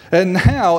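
ordinary talk

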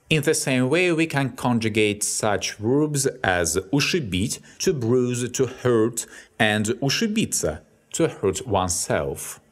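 ordinary talk

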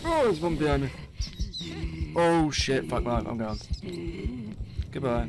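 loud, high panicked yelling; panicked screaming. Real, not acted. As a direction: scream it as she chases you. Monster right behind him.

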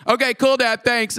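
put-on high, offhand kid voice; lightly acted child saying okay; child's reply. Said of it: "one of them was like".

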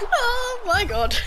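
high, slow words through laughter; helpless laughter. Real, not acted. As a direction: squeeze it out while cracking up. Laughing after double homicide.

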